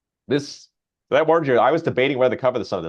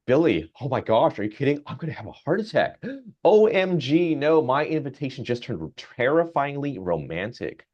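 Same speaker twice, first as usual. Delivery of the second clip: high, breathless excitement; thrilled gushing; 5 semitones higher than usual. Retold or imitated. Old man gushes to Billy the chatbot, high excited gasps. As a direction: gush like a smitten teen.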